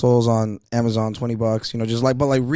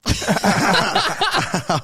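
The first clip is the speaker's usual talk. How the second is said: laughing; 11 semitones higher, 3 dB louder than usual. Real, not acted. Laughing at himself.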